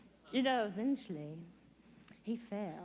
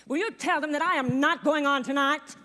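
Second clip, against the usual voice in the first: loud, indignant star outburst; loud backstage protest; indignant refusal; 3 semitones higher, 8 dB louder than usual. Acted. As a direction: refuse loudly, like a diva backstage.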